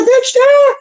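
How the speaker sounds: high, loud sassy yes; mock sassy agreement. Retelling a chat about sounding Texan, high loud sass. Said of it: "And I was like".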